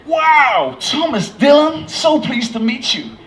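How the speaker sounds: starstruck American, very high and drawn out; bad American accent. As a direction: gush slowly in a hammed-up American voice. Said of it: "a terrible American accent".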